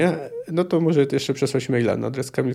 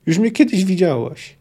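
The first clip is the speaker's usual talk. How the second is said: shouted plea; 6 semitones higher, slower than usual. A narrator's reading. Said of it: "krzyknęła Nimue".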